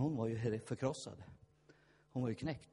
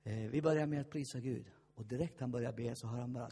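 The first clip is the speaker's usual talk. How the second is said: normal talking voice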